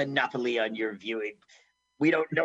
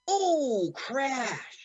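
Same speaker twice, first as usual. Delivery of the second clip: mock crash sound; 9 semitones higher than usual.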